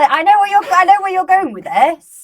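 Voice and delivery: high and loud, excited; bursting with excitement. Real, not acted. Excited teasing protest.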